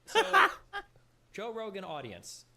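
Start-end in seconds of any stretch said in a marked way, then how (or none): 0.3-0.8 short, loud, high fake laugh; sarcastic laugh. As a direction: burst of mock laughter. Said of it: "What if I sarcastically laugh".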